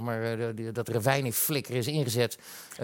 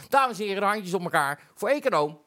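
higher, rousing show-host introduction; announcer introduction; 5 semitones higher, 3 dB louder than usual. Real, not acted. Host bringing on his economist guest, announcer style. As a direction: call up applause for the guest.

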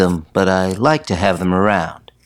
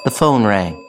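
ordinary delivery, nothing marked

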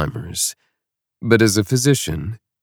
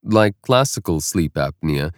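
ordinary delivery

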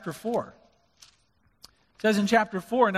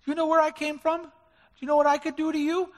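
higher, cocky threatening brag; mock-tough braggart; boastful challenge; 8 semitones higher than usual. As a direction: puff up like a bully. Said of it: "he didn't say that".

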